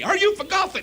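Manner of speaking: mock-angry Southern accent, words run together; mad goober. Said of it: "Now he's mad at me".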